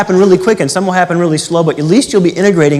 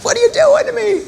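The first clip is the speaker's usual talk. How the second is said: very high squeaky cry of protest; mock betrayed squeal. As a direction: squeal like a cheated buyer. Signers regret the contract.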